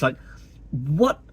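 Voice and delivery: ordinary talk, nothing marked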